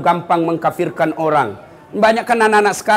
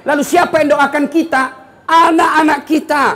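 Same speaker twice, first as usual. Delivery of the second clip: higher, louder rousing call; 8 semitones higher, 4 dB louder than usual. Real, not acted. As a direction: lift the crowd. Preacher rousing the crowd. (berseru penuh semangat)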